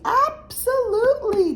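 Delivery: high, slow, loud comic stretch; emphatic. Real, not acted. A mom admitting her belly with a comic drawn-out yes. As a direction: drag it out for the joke. Joking admission about belly.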